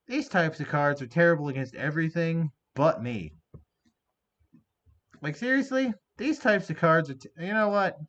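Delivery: building into loud furious shouting; angry rant. Real, not acted. Gamer ranting at the opponent's card, getting angrier.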